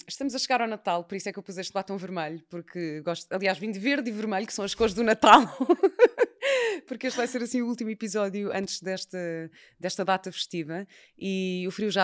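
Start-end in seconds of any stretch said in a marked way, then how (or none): 5.4-6.8 a real burst of high laughter; bright laugh. Real, not acted. Host laughing about her Christmas colours, loud and high. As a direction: laugh loudly at her own Christmas joke.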